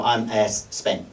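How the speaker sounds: slow, lightly put-on Spanish accent, stretching "es Spain"; a hint of a Spanish speaker saying "I'm es Spain", drawn out; mock Spanish accent. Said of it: "'cause they go".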